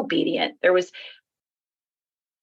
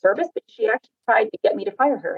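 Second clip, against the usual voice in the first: ordinary talk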